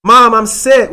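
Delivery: put-on whiny sick kid. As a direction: ham up the fake sick kid. Kid faking sickness to mom.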